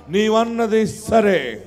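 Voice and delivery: నీవన్నది సరే drawn out slowly and evenly; unhurried నీవన్నది సరే; slow concession. Acted. Repeated phrase, first take.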